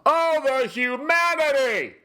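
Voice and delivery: high, loud, anguished radio cry; Hindenburg announcer wail. Retold or imitated. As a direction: wail it like the 1937 reporter watching the airship burn. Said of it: "Imagine the Hindenburg disaster".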